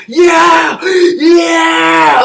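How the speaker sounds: long wailed cheer, put on; mock triumphant yell. Chelios on fire celebrating, drawn-out mock yell.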